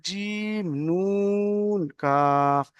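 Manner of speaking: very slow recitation of letter names; drawn-out letter recitation. Shown as a demonstration. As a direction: stretch each letter name long and sung. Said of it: "যদি একটা থাকতো".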